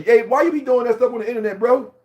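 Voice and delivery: high, loud challenge; calling out. Real, not acted. Confronting a fake vlogger, high and loud. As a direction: demand an answer.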